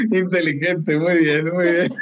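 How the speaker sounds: chuckling through the word; laughing. Real, not acted. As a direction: laugh as you praise it. Laughs at clever wish.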